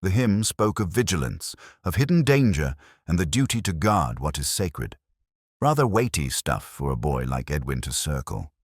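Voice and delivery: ordinary delivery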